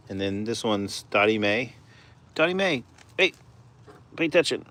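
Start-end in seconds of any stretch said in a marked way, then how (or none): 2.3-4.6 high sing-song call to a hen. Real, not acted. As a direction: call her sweetly, pitched way up. Calling to a chicken.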